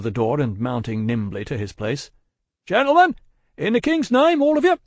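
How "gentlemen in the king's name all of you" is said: very high, loud rallying shout; urgent adjuration. A narrator's reading. Guard rallying passengers against danger, urgent shout. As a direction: shout a hurried order to everyone. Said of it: "With his hurried adjuration".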